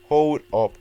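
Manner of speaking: sharp loud stop; loud hold up. Real, not acted. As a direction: cut in loudly. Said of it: "We need to do this right".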